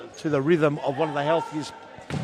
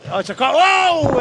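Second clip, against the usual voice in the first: sudden high, loud yelp at the incoming shot; startled cry; 9 semitones higher, 7 dB louder than usual. Real, not acted. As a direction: jump as the ball comes at you. Shot flies toward commentator.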